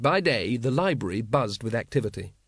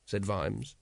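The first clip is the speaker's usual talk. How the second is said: ordinary delivery, nothing marked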